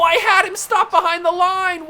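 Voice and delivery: high, loud, outraged yell; angry gamer screaming at the screen; furious shout. Real, not acted. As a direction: shout it in disbelief at the game. Real rage at in-game play.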